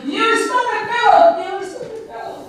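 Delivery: very high yell, fading on the repeat; acted high-pitched outburst; angry shout. A narrator's reading. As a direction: hurl the insult, then let it drop away. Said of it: "he angrily shouted".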